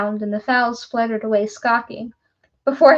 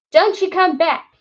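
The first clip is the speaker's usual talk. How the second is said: higher, louder scream; 6 semitones higher, 6 dB louder than usual. Acted. Woodcutter screams after departing visitor, loud. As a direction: yell it after him as he leaves. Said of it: "he screamed after me".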